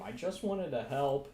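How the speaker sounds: high plaintive yell. Acted. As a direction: yell it out, hurt and whiny. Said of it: "Chop Trap apparently yells into the room".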